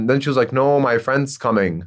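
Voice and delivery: usual speaking voice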